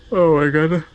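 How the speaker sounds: words stretched through a big yawn. Real, not acted. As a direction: yawn while you talk, slow. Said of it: "Apologies for yawning".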